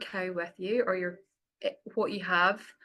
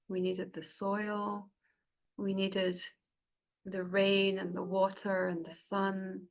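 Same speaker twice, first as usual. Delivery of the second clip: unhurried meditation voice with long pauses; guided meditation. Acted. Facilitator guiding the group to close their eyes, guided meditation. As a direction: ease them gently inward, phrase by phrase. Leads a cacao ceremony meditation.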